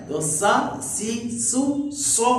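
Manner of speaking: slow demo of the thin whistle sound of सीन; whistling sibilant demo. Shown as a demonstration. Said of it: "सीटी की आवाज बारीक है".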